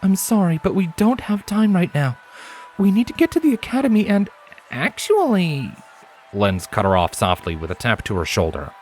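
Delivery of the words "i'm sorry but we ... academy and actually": high mare's voice, gently firm; Scarlet, firm but kind. A narrator's reading. Scarlet telling Primrose there is no time, firm. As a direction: say no kindly but firmly.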